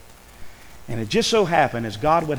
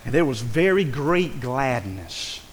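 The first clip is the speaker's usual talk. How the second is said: slow, solemn reading of the verse; slow scripture reading; slower than usual. Shown as a demonstration. Preacher repeating the verse for the church, slow and solemn. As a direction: lean into the verse slowly. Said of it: "Isn't that what he said?".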